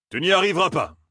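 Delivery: high-pitched exclamation; exclaimed. A narrator's reading. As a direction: cry out in protest. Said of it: "s'écria le contremaître". (en s'écriant)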